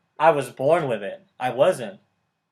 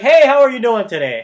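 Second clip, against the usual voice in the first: much higher and louder hello; greeting a random stranger at work, beaming and loud; cheery greeting; 8 semitones higher, 11 dB louder than usual. Retold or imitated. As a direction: greet a stranger like an old friend. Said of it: "I'm just like".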